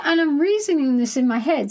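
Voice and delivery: ordinary talk, nothing marked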